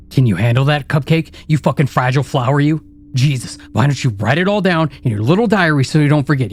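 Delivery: from a sneering taunt to a high screaming rant; building to a scream. Acted. Inner critic rant builds to screaming.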